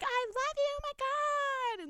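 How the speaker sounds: mocking high-pitched fangirl squeal; annoying fangirls. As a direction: draw it out in a very high mock squeal. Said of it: "Girls are being annoying as fuck".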